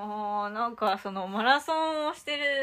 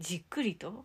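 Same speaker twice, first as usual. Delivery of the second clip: whispering; 11 dB quieter than usual. Real, not acted. Guessing the answer quietly.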